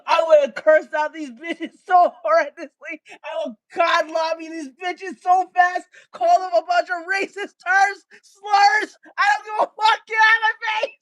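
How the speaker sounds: usual speaking voice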